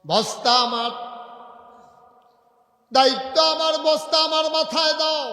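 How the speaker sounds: very high, loud commanding cry; impassioned insistence. Acted. Umar demanding the sack from workers, impassioned shout. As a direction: shout the demand at full force.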